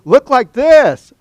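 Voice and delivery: loud, high, drawn-out order; mock ad command. Advertisers ordering the miserable 'before' model to pose, loud mock command. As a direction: belt it out slowly, word by word. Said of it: "they must tell 'em".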